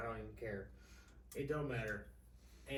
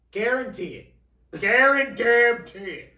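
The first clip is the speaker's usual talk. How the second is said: booming slow shout, much higher; loud guarantee; 10 semitones higher, 16 dB louder, much slower than usual. Real, not acted. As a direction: bellow it like a bold promise. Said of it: "I guarantee it".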